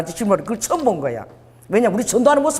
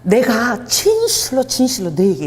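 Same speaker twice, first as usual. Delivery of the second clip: slow, solemn 진실로; voice of the Lord; 3 semitones higher, slower than usual. Retold or imitated. Jesus speaking to the disciples, slow and solemn. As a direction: intone it like scripture. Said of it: "주님이 이렇게 딱 얘기하는 거야".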